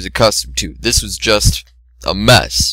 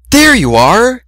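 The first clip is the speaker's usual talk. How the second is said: high, loud gleeful call; excited call; 13 semitones higher, 5 dB louder, slower than usual. A narrator's reading. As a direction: pounce on the words with glee. Fluttershy finds him, calling.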